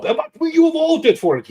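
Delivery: high, squeaky mock complaint; put-on high-pitched heckler; mocking imitation of critics. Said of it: "bunch of the creeps who go out".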